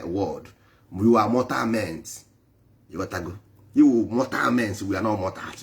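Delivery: ordinary talk, nothing marked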